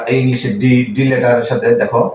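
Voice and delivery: ordinary talk, nothing marked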